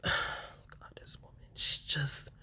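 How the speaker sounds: whispered under the breath with a sigh; exasperated whisper. Real, not acted. Venting to viewers about Laura, exasperated whisper. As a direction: breathe it out in weary disbelief.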